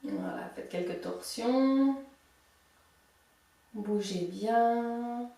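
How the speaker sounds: soft, slow guiding voice; calm relaxation cue; guided meditation. Acted. Leads out of relaxation.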